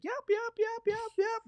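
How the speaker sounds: very high squeaks; squeaky fleeing dog. Retold or imitated. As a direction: yip like a dog flung into the air. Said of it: "the dog's like".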